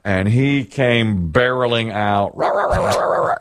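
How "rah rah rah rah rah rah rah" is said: rough barking noises; imitating a barking dog. Retelling the dog bursting out of the laundry room: mock barking. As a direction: bark like a charging dog.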